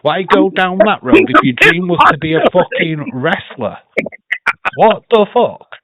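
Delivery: loud, high exasperated shout; host ranting about a celebrity wrestling; angry rant. Real, not acted. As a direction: blow up in disbelief. Ranting about [name].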